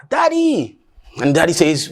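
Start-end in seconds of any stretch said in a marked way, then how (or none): 0.1-0.8 high call to dad across the field; a boy's high call carried over the farmland; calling from afar. Acted. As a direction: shout it out to a distant father. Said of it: "the boy yells from afar".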